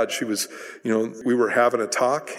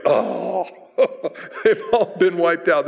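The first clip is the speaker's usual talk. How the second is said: mock groan breaking into a laugh; raised voice, laughing in pretend dismay; 5 semitones higher, slower than usual. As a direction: laugh through a hammed-up lament. Mock groan at all the bummers.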